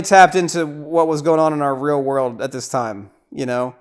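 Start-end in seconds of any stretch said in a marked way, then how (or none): none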